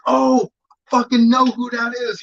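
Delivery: like it just clicked who the guy is; hammed-up "oh, I know him" realization; sudden recognition.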